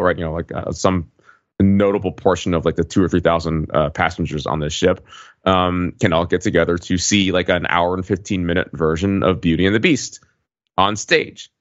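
ordinary talk, nothing marked

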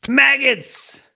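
high, put-on sneer; hammed-up barked word; mock drill sergeant. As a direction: spit out the insult like a sergeant. Echoing drill sergeant insult.

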